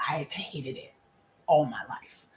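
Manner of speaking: hushed, breathy confession; a real whispered admission. Real, not acted. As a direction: whisper it like a secret. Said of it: "whispered confession".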